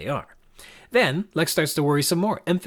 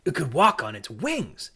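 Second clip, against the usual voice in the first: slow, wondering exclamation; acted fascination, drawn out; surprised discovery; slower than usual. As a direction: marvel at it with swooping pitch. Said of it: "comes as a surprise discovery".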